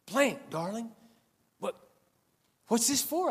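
lightly voiced mechanic, taken aback; rough mechanic voice. Mechanic voiced in a retold scene.

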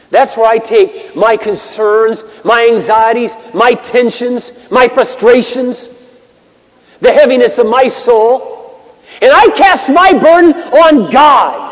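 high, slow litany building to a shout; impassioned preaching. Real, not acted. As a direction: build each item toward a loud climax.

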